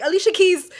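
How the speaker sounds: high, loud eager answer; pitching the song excitedly; excited suggestion. Retold or imitated. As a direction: blurt it out eagerly. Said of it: "And I was like".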